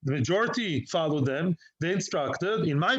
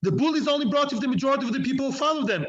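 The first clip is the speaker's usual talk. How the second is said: excited; 6 semitones higher, faster than usual. Real, not acted. Heated rebuttal in Talmud lesson.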